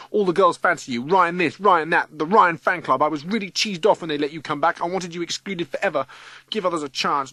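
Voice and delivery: high, tumbling confession building to a loud wail; rattling rant. A narrator's reading. Matthew confessing to Ryan why he hates him, rattling it out. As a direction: spill words like popcorn heating up. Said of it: "words rattling like popcorn being heated up".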